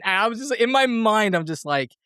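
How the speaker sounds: ordinary delivery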